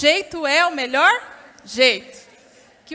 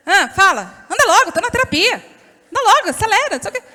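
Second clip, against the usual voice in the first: louder, higher, fast hurrying; rude impatience; 5 semitones higher, 5 dB louder than usual. Retold or imitated. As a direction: snap at the husband to hurry up. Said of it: "Às vezes a pessoa fala assim".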